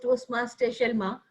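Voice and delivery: ordinary talk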